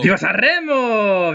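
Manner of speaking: slow, exaggerated Spanish accent. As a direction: sing out the cheer in a Spanish accent. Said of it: "Pourquoi je prends l'accent espagnol".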